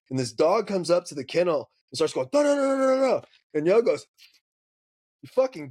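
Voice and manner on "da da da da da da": high yappy mock bark; imitating a dog yapping. Dog in the kennel yapping at them, high mock bark.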